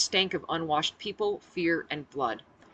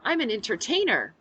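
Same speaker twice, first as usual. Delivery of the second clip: bright forced smile; 5 semitones higher, 4 dB louder than usual. A narrator's reading. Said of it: "I smiled brightly through clenched teeth".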